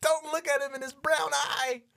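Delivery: high, loud, laughing warning; laughing mock warning. Friend laughing through a fake horror warning. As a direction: cry out the warning, cracking up.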